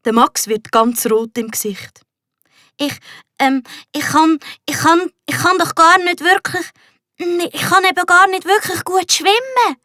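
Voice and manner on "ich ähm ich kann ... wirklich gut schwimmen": higher, embarrassed stammer with repeats; boy blushing as he admits he can't swim. A narrator's reading. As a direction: trip over the words in shame. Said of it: "stottert er".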